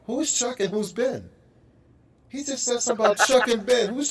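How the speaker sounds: high puzzled mock confusion; an American baffled by British slang; confused. High, baffled voice. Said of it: "I'm going like".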